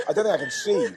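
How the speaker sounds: high breathless laughing wheeze; wheezing laugh. Real, not acted. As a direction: laugh through watering eyes. Eyes watering from chili.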